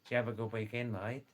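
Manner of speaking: Australian slur, "Did you have a" run into "Javva"; broad Aussie "mate", words run together; broad Australian accent. Shown as a demonstration. Showing listeners how Australians ask about the weekend, broad Australian accent. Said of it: "that actually means".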